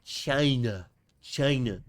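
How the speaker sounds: Trump saying China, drawn-out vowel; Trump impression. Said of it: "on my Donald Trump shit".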